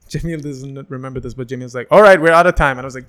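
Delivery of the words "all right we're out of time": loud, raised host's cutoff; big projected wrap-up call; announcing loudly. Retold or imitated. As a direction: imitate an emcee ending a set. Host cuts his set short.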